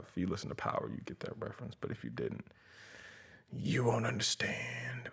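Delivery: hushed conspiratorial whisper; secretive whisper. Acted. Secretive aside about a reference.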